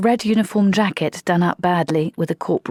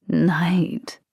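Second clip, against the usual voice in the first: eerie whisper. Acted. Vampire spooking the old corporal.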